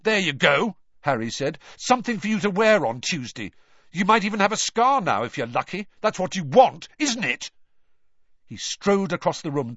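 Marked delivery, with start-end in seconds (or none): 0.0-7.5 high, loud sneering jab; bitter taunt. Acted. Harry throwing a badge at Ron, taunting loudly. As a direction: taunt him, mock-generous.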